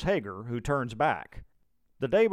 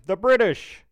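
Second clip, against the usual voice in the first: high, loud shout of alarm; yelled warning; 8 semitones higher, 9 dB louder than usual. A narrator's reading. Militiaman warning of approaching redcoats, yelling. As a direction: shout it out to rouse the camp. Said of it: "begins yelling".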